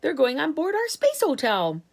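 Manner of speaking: राष्ट्रपति उत्साह से चिल्लाते हुए; shouting. Acted. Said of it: "shouted the president".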